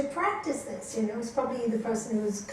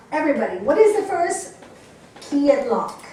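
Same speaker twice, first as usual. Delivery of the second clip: louder, higher quiz question; calling out to the room; 3 semitones higher, 9 dB louder than usual. Real, not acted. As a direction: call out to the whole hall. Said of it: "teacher quizzes the audience".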